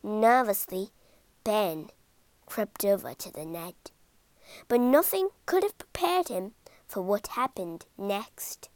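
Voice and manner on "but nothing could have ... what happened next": ordinary delivery, nothing marked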